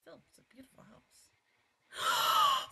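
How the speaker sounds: slow, awed whisper. Real, not acted. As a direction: breathe it out in awe. Awed at the house.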